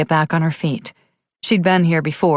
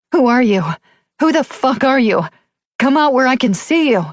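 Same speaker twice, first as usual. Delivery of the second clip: frantic shout; 8 semitones higher than usual. A narrator's reading. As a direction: yell into the empty house, panicking. Screaming at unseen intruder.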